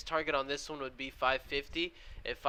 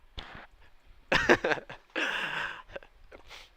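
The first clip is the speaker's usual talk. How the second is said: laugh; 5 dB louder than usual. Real, not acted. Laughs after funny remark.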